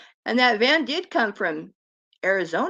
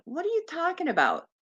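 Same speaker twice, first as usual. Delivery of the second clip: puzzled mechanic; 6 semitones higher, 5 dB quieter, faster than usual. Retold or imitated. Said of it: "The guy's going".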